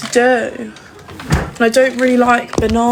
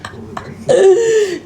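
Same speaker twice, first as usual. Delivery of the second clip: high giggle; 8 semitones higher than usual. Real, not acted. Laughs at the dog.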